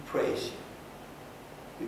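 breathy hushed whisper of worship; whispered praise. Real, not acted. Pastor murmuring praise to God mid-prayer, whispered. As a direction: breathe the praise almost silently.

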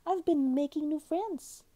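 high, loud, happy little seal; smiling. A narrator's reading. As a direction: announce it proudly with a smile. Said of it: "he said, smiling at Atka".

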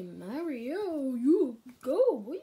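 loud, swooping cheer; playful cheering. Acted. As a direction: whoop it up for the contestant. Host cheers Mario on.

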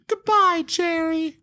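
high, tender farewell mimic; imitating girlfriend. Girlfriend bidding the mouse farewell, high tender mimic. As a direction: say goodbye sweetly to the mouse. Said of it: "and she goes".

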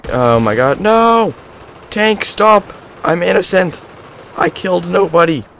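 high, loud panicked begging; panicked pleading. Real, not acted. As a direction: beg the tank not to shoot. Panicked pleading to enemy tank.

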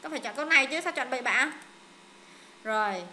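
ordinary talk, nothing marked

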